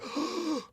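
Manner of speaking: sharp high gasp of shock. Retold or imitated. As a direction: gasp as if seeing the stunt. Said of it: "I was like".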